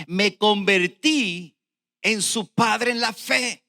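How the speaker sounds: very slow, loud proclamation; preached scripture reading. Real, not acted. Preacher reading Paul's words on Onésimo, loud and drawn out. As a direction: hammer out each phrase of the verse. Reads the verse emphatically.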